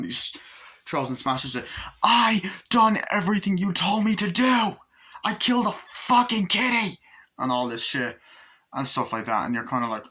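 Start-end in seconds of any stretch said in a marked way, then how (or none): 2.0-7.0 high, loud acted tirade; imitating a furious, pitched-up outburst; mock enraged yell. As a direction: scream it as the desperate heroine. Acted outburst while smashing things.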